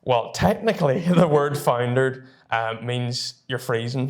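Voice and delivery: normal talking voice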